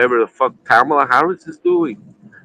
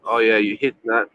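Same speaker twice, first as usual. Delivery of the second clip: ordinary talk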